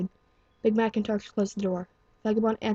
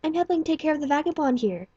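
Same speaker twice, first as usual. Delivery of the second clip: higher, cheerful helper voice; bubbly pink pony; 7 semitones higher than usual. Acted. Pink pony tells the doctor she helps, high and cheerful. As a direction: sound proud and eager to help.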